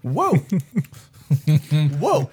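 high surprised whoa with a laugh. Real, not acted. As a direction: yelp at the sour taste. Reaction to a sour sip.

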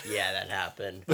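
low, soft flat deadpan. Retold or imitated. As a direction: say it like nothing happened. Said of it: "That's how Rhodey gives birth".

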